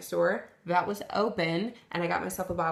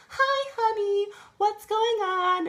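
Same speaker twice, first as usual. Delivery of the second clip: much higher, slow sweet mom voice; imitating mom; 14 semitones higher, 4 dB louder, slower than usual. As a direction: coo it like a caring mother, slowly. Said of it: "she was like".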